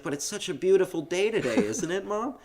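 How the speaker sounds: high, singsong child imitation; eager little kid. Host as boy coaxing his sleepy mother, high and chirpy. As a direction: coax mom in a high kid voice. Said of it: "and he's like".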